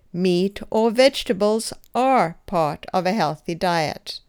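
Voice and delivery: "are" given a clear push of stress. Shown as a demonstration. Teacher models the answer, stressing "are". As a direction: lean on "are", the verb. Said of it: "Emphasize the verb as I did".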